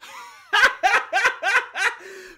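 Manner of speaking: high loud delighted laugh; loud burst of laughter. Real, not acted. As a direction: crack up laughing.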